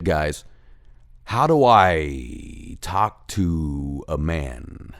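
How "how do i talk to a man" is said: very slow, lower-pitched plea; exasperated drawl. Real, not acted. Comic ranting after the straw story, in a slow exasperated drawl. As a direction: drag out each word in exasperation.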